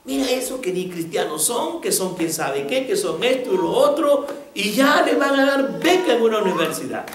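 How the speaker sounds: building from indignant to a loud, high rant; replaying the angry student's complaint, rising toward a shout; mock angry student. Angry student voiced in story.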